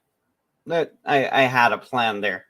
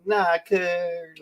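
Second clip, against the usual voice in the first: higher, slangy mock reply; 5 semitones higher than usual. Hanging out with a friend, imitated slangy reply. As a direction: lightly mimic a pal's casual slang.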